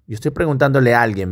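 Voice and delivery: ordinary delivery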